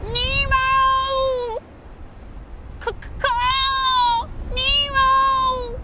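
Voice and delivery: high, long-held monotone wail; mock-impaired gull call, stretched out; hammed-up injured bird crying out.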